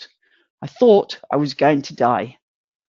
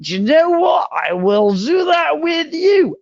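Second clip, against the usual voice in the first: eager yes; 10 semitones higher, slower than usual. Retold or imitated. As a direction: blurt it out like a tipsy promise, drawn out. Tipsy yes to a marathon.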